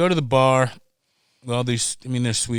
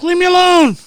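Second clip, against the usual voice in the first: much higher, much louder cry; mock yell; 10 dB louder than usual. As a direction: yell it while fleeing the cops. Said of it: "I'm like".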